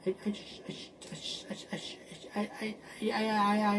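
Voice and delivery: stuttering whisper; stammer, fading to a whisper. Real, not acted.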